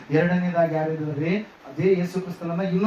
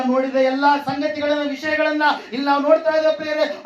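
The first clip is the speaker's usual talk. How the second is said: high, sustained scripture proclamation; fervent preaching; 10 semitones higher, 4 dB louder than usual. Acted. Preacher telling of Hagar weeping for her child, fervent preaching. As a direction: proclaim the verse on a raised, ringing pitch.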